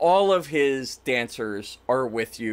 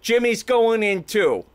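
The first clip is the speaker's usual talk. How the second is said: stage magician announcement; 7 semitones higher, 5 dB louder than usual. Acted. Jimmy announces his trick to the audience, grandly. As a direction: call it out to the whole crowd.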